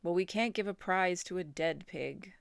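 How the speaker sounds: a hint of a stern announcer; firm announcer. A narrator's reading. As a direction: state the rule over the PA. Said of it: "said the loudspeaker".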